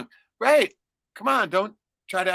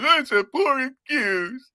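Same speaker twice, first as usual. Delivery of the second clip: high, slow, huffy farewell; imitating the offended commenter storming off; mock offended guy; 7 semitones higher, 3 dB louder, slower than usual. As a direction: drag out the wounded goodbye. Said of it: "he goes".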